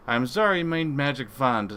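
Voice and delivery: high, loud put-on accent; Wolfbane. [name] mimicking a crewmate's story, exaggerated put-on accent. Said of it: "puts on his best Wolfbane's accent".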